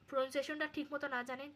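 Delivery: ordinary talk, nothing marked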